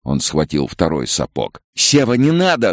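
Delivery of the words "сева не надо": high-pitched urgent cry; alarmed mother's high shout; screaming. A narrator's reading. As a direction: scream out to stop him. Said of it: "закричала Ася".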